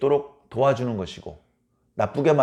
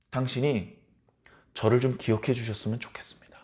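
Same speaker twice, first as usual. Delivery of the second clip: soft whispered prayer; whispered plea; 4 semitones lower, 8 dB quieter than usual. Acted. The thief whispering his plea to the King of kings. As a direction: breathe the request as a whisper.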